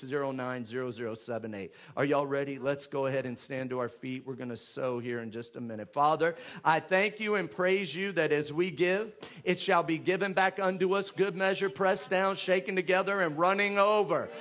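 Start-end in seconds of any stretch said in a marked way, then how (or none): 5.9-14.3 loud, fervent preaching prayer; declaring the blessing on the giving; prayer at full force. Acted. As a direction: pray it out boldly over the offering. Leads offering prayer loudly.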